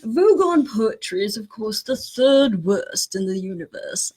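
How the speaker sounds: put-on amateur British accent, reading aloud. Host reading the book to co-host, hammy British accent. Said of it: "horrible British accent".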